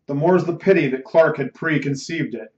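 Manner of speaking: plain reading voice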